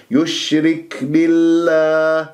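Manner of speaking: slow recited يشرك بالله with a light puff on k; gentle kaf breath. Shown as a demonstration. Teacher showing the right way, gently breathed kaf. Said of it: "بهذه الطريقة".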